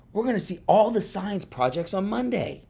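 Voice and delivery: high-pitched little hamster voice; excited hamster. A narrator's reading. Classroom hamster to his frog friend, excited. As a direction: pipe up high, thrilled with the news.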